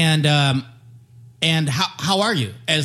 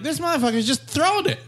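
mocking onlooker; 11 semitones higher, faster than usual. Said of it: "other people on other teams going like".

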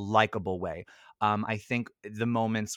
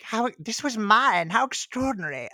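put-on Old Rose; 11 semitones higher, 3 dB louder than usual. Finding her recovered things, high wondering old-woman voice. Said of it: "and she's like".

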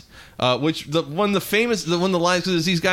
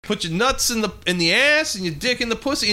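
higher, excited cheering yell; hyped-up shout; 7 semitones higher than usual. Retold or imitated. Quoting the porn posse cheering Tone on, higher rowdy shouting. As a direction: egg him on like a rowdy buddy. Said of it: "they're kinda like".

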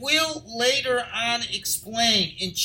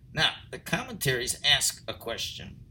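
ordinary talk

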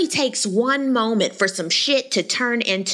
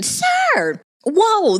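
shocked outburst; 11 semitones higher, 4 dB louder than usual. Real, not acted. Shocked at his talk.

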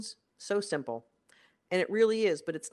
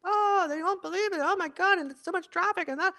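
much higher, whiny gossip voice; mock complaining; 13 semitones higher than usual. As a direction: parody yourself griping to your partner. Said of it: "complain to her".

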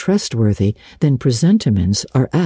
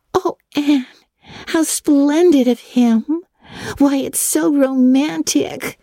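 breathless; 14 semitones higher, slower than usual. A narrator's reading. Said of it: "finding breath enough for utterance".

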